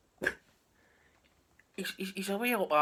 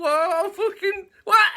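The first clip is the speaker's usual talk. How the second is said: dismayed what; 12 dB louder, slower than usual. Retold or imitated. Bones dismayed, drawn out.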